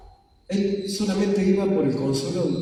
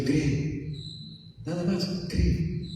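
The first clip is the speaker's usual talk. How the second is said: softer, gentle and hushed; tender plea; 3 semitones higher, 7 dB quieter, much slower than usual. Acted. Jesus holding Marta's hand, tenderly and softly urging her. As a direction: coax her gently to believe. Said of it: "tomándole la mano a Marta y diciéndole".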